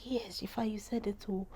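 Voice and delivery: whispered mother's reply; whispered mother's admission. Retold or imitated. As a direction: breathe it like a mother's quiet admission. Mother's answer quoted as whisper.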